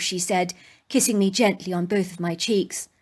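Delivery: plain reading voice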